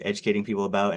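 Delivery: ordinary talk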